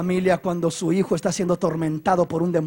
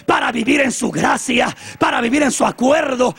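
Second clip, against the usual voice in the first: building, raised preaching declaration; loud, rhythmic declaration; emphatic confession; 6 semitones higher, 4 dB louder than usual. Acted. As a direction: climb in pitch to a forceful vow. Acted parent's confession, building declaration.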